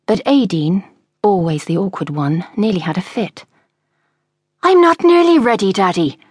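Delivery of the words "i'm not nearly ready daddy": high, loud angry protest. A narrator's reading. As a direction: snap it back at Daddy, much higher and louder. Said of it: "she said angrily".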